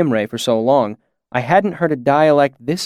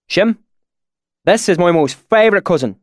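higher, louder rural dialect; put-on dialect; 6 semitones higher, 4 dB louder than usual. A narrator's reading. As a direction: quote folksy wisdom in dialect. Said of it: "my sudden change in dialect".

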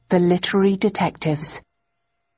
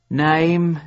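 weary sergeant; 3 dB louder than usual. A narrator's reading. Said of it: "asked the desk sergeant wearily".

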